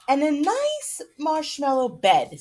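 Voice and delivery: lion voice. Acted. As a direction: give the lion a hopeful little voice. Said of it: "said the lion".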